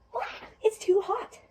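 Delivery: loud, high, drawn-out bleh; disgusted. Acted. Goldilocks tasting porridge that is too hot, disgusted. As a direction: recoil from a scalding mouthful.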